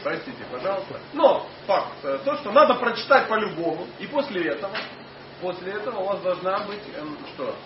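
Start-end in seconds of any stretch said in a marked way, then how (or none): none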